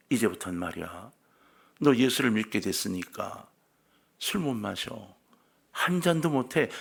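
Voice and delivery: low, soft and sly, slipping a word in; sly quiet murmur. Acted. Old habits tugging at Augustine's sleeve, speaking low and softly. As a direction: lean close and quietly tempt him. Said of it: "슬그머니 한 마디를 던지는 거예요. 고요히".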